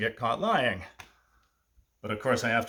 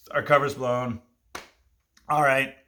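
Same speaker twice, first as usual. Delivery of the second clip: ordinary delivery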